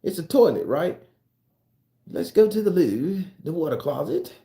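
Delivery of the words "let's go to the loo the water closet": mock British accent. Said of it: "they have a way of talking".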